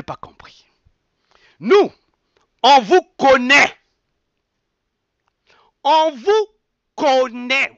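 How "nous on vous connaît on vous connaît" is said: loud, slow and high, every word hammered; emphatic confrontation. Real, not acted. Speaking straight to the old leaders, loud accusing call-out. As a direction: call them out to their faces.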